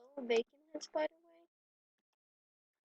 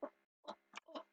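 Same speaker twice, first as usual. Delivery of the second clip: ordinary delivery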